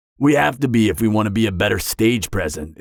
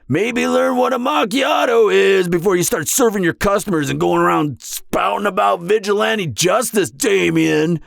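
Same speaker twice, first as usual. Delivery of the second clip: exasperated shout at a rival; 8 semitones higher than usual. Acted. Ranting at rival coffee-shop vigilante.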